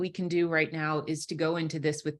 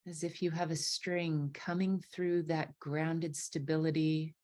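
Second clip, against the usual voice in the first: guided meditation; 5 dB quieter than usual. Acted. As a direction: ease them into their breath, unhurried.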